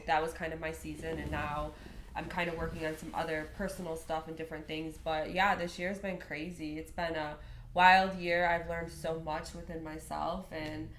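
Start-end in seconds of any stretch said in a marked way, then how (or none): none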